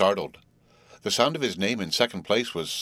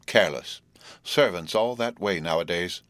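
ordinary delivery

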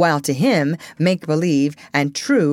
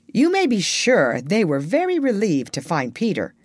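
ordinary delivery

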